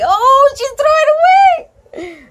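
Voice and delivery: high, loud gasp of dismay; shocked cry. Real, not acted. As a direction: cry out in disbelief. Shocked reaction while reading.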